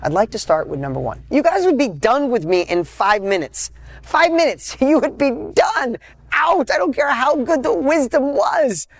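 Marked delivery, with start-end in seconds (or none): 1.3-3.7 higher, lifted delivery; raised enthusiasm. Real, not acted. Speaker warns the audience they'd leave, lifted. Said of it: "this is authentic enthusiasm". 4.0-8.9 much higher, with a laugh; real enthusiasm. Real, not acted. Speaker teasing the audience about leaving, much higher and excited. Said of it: "this is authentic enthusiasm".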